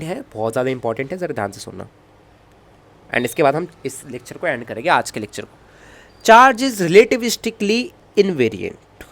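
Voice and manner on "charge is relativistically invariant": very slow, syllable-by-syllable dictation; teacher dictating the last property; dictating slowly. Shown as a demonstration. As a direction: draw out "relativistically" for notes. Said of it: "slow dictation of property".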